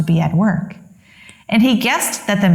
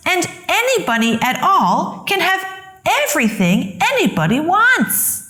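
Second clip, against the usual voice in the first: put-on higher, bright-eyed kid's voice of joy; Sylvester delighted; 8 semitones higher than usual. A narrator's reading. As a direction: marvel at your luck like a young donkey. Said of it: "thought Sylvester".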